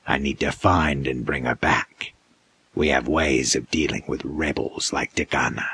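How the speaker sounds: steady and chilling; menacing creature. A narrator's reading. Creature explains its hunt.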